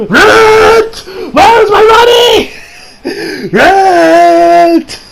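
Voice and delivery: shrieking landlord demand, very high and loud; Mister Dinkovich. Pretend. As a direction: screech for the rent like a crazed landlord. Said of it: "I had to put some Spider-Man references".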